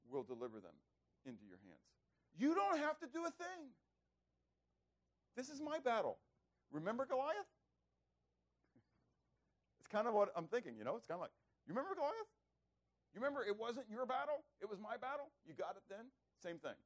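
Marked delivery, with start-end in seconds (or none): 2.4-7.5 high-pitched, insistent reminder; acted exasperated reassurance; God. 11.7-16.1 high, prodding questions; pointed, high-pitched reminder; God. Acted. God's reminder re-enacted as pointed questions.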